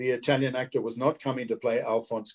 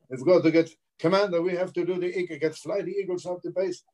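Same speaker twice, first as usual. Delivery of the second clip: put-on raised-pitch stage Italian accent; 6 semitones higher than usual. Actor auditioning as a moon base commander, Italian accent. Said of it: "I do my Italian accent".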